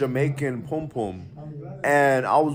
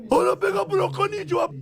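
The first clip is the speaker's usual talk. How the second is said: much higher and fast, like a chipmunk; squeaky sped-up voice; faster than usual. Pretend. Hyping up the konnichiwa phrase in a squeaky, fast voice. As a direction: squeak it out quickly, playfully.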